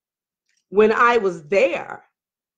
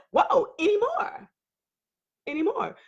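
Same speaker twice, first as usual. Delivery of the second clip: incredulous echo; 8 semitones higher, 6 dB quieter than usual. Retold or imitated. Wife reacting to mistress's pregnancy answer, incredulous.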